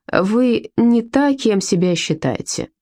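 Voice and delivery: slow and weighty, a cryptic warning; slow ominous. Acted. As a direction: drag it out like a dark secret. Ominous line before hanging up. (медленно и зловеще)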